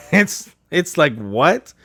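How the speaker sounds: high, laughing incredulity; slow down into a baffled, laughing question; laughing disbelief. Real, not acted. Gamer baffled by English accents in France, laughing in disbelief.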